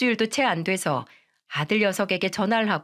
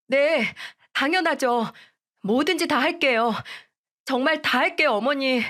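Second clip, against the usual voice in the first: put-on higher, begging young wife voice; pleading daughter-in-law; 6 semitones higher than usual. Daughter-in-law begging mother-in-law and husband, higher pleading voice. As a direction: plead desperately, a touch tearful in tone.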